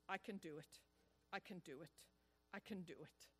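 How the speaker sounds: quiet self-encouraging murmur; boy talking to himself. Retold or imitated. Little boy psyching himself up alone, quiet murmur. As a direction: hush it down, coaxing yourself on. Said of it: "talking to himself".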